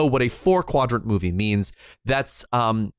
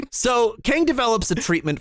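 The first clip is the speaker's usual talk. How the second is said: ordinary talk, nothing marked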